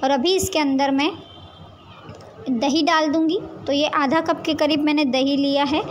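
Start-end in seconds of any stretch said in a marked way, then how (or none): none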